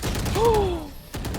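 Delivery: excited gasp. Real, not acted. Caster reacts to round win.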